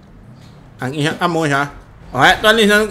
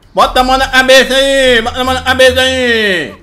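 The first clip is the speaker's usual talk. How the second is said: higher, louder police command; shouting orders; 7 semitones higher, 4 dB louder than usual. Acted. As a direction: bark it like a cop. Cop orders suspect, shouting.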